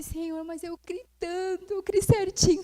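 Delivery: hammed-up weepy prayer; imitated crying. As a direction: retell a tearful complaint to God, stretched out. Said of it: "E eu chorando".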